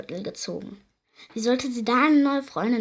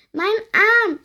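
high, louder creature wail; 11 semitones higher, 8 dB louder than usual. A narrator's reading. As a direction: moan it like a hurt goblin. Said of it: "jammerte die Stimme". (hohes, jammerndes Wesen)

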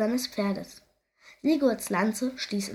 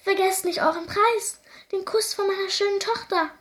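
much higher call; pitched-up urgent call; 10 semitones higher than usual. Acted. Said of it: "rief der König".

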